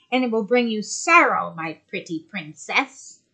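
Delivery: loom over her as the sea witch. Acted. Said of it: "said the sea witch".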